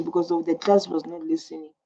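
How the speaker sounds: usual speaking voice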